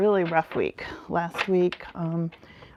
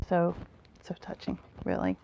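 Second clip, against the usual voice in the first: touched whisper; 3 semitones lower, 8 dB quieter than usual. Real, not acted. Moved by viewers' support.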